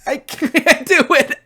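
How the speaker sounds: slow, breaking into laughter; can't get it out for laughing; high, giggly give-up. Real, not acted. Laughs at the thought.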